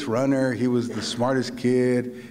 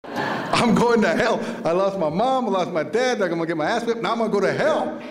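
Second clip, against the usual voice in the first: put-on louder, higher childish wail; hammed-up panic; 4 semitones higher, 6 dB louder than usual. Five-year-old sure the devil has him, comic panic. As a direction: wail it like a doomed little kid.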